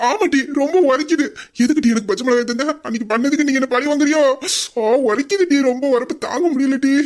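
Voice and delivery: high, accusing wail; hammed-up chilli agony; pained shouting. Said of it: "சரியா, கத்தாதயா".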